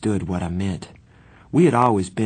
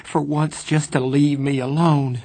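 high, louder anguished plea; voice breaking; 7 semitones higher, 3 dB louder than usual. A narrator's reading. Johnny begging to be left alone, voice breaking. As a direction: cry it out, voice pitched up. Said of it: "His voice broke".

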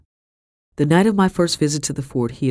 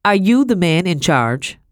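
slow, raised, imperious question; cracking across the room; 4 semitones higher, 5 dB louder, slower than usual. A narrator's reading. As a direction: throw it loudly across the room. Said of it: "Madame's voice cracked across the room".

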